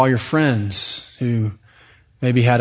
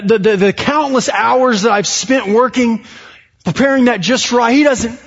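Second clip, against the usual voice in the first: high, loud defensive outburst; indignant rant; 9 semitones higher, 4 dB louder than usual. Retold or imitated. Worker fuming about his boss, high and loud indignant rant. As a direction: vent the wounded pride, voice climbing high and loud.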